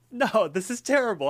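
high, loud pretend protest; mock dismay. Baker imagines seeing their own bad pie, mock dismay. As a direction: exclaim it as if appalled at your own work.

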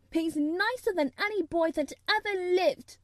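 high, fervent insistence; heated defence. A narrator's reading. Mary defending Dickon to Colin, heated. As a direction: champion him hotly.

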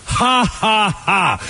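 taunting fake laugh, each ha distinct; mock gloating laugh. Gloating at rival fans.

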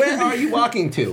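exasperated viewer, half laughing; mock bafflement. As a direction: question the cartoon couple in playful disbelief. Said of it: "you're like".

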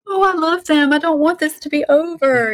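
high, gushing fond sigh over a book character; gushing fan. Retold or imitated. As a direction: swoon like you can't bear the story ending. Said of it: "you're like".

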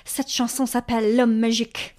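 put-on speaking French with a French accent; mock French accent announcing a song. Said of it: "saying in her best French, uh, accent".

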